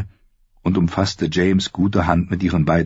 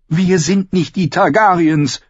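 high woman's voice, sharply insistent; emphatic sister; 13 semitones higher, 5 dB louder than usual. A narrator's reading. Sister rejects brother's Targaryen example, emphatic. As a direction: reject it in a high, forceful female voice. Sister throws the name back.

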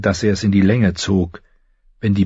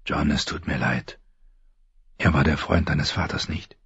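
softer, lower, tender condolence; sympathetic young friend; 5 semitones lower, 8 dB quieter than usual. A narrator's reading. Friend leaning close to console John, sympathetic and hushed. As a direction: offer gentle sympathy, low and soft.

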